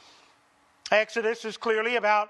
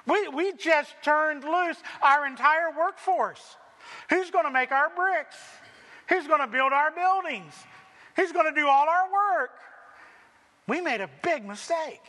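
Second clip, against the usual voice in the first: higher, alarmed voice of Pharaoh's court; panicked Pharaoh; 6 semitones higher than usual. Retold or imitated. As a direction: play Pharaoh's men realizing their mistake. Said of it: "it dawns on".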